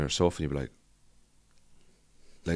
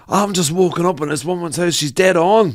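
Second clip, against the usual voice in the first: much higher, louder put-on voice of the man; Glasgow guy; 10 semitones higher, 8 dB louder than usual. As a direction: ham up the bewildered lad from the video. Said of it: "And he goes".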